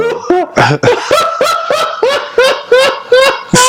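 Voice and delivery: high wheezy cartoon snicker; cartoon cat laugh. Real, not acted. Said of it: "you do sound like that cat".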